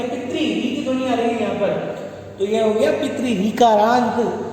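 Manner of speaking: normal talking voice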